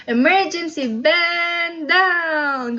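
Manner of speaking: very slow, stretched-out call; drill command style. Shown as a demonstration. Tourism student showing the required delivery, long stretched call. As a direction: hold "band" and "down" long. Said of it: "Kailangan ganun yung pagkakasabi".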